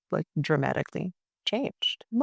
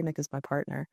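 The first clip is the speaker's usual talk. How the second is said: ordinary delivery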